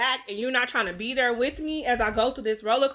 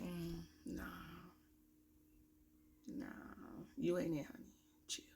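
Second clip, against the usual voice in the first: dismissive; 4 semitones lower, 21 dB quieter than usual. Retold or imitated. Her response to old friends.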